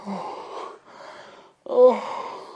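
breathy, almost voiceless oh's; grieving sigh. Acted. God sighing over his people, soft and breathy. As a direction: let the sigh carry the heartbreak.

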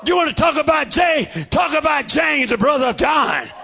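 shouted, driving sermon cadence; fiery preaching. Real, not acted. Preacher shouting his testimony to the church.

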